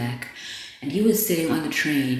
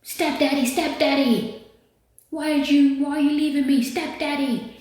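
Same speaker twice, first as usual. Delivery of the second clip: mock yelling; 12 semitones higher, 3 dB louder than usual. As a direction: imitate a heckler calling out loudly and high. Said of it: "kept yelling".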